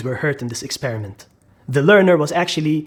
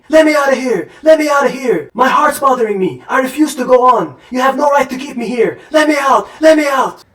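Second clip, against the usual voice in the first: loud, higher-pitched desperate pleading; acted screaming; 7 semitones higher, 4 dB louder than usual. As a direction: yell like a trapped learner begging for release. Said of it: "who was screaming".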